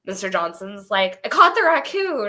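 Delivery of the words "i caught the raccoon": high, loud mock announcement; excited retold announcement. Co-host retelling Mister Johnson's raccoon catch, excited mock announcement. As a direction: call it out as an imitated proud catch.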